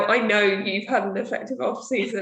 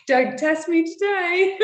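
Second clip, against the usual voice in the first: mock menacing warning; 9 semitones higher, 4 dB louder, slower than usual. Jokingly voicing an irritable self.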